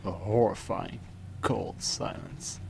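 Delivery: slow, breathy hush on cold silence; hushed dread. A narrator's reading. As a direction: let it drop to a chilling hush. Dread-filled horror narration.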